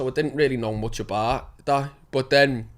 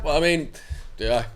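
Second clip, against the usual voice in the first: ordinary talk, nothing marked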